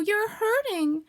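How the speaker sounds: very high, drawn-out complaint; high acted hurt; pained cry. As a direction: wail slowly as your paw is pulled. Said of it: "cried Pooh".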